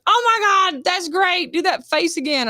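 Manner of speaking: excited director. Retold or imitated. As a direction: gush about it like a thrilled director. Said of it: "the director was like".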